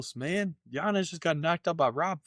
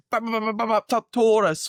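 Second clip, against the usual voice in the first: imitating Conor; 10 semitones higher, 5 dB louder than usual. Podcaster mimicking fighter's trash talk, high hammed-up. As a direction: ham up the trash-talker's bouncy taunt. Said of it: "he's fucking hilarious".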